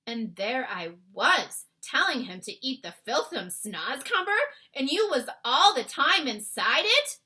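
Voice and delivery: dismayed cry. A narrator's reading. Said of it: "the B F G cried".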